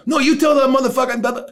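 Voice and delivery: high-pitched, heated comeback; imitating an irate man arguing back; retold angry retort. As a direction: refuse to calm down, voice shooting up. Retold angry retort to calm-down plea.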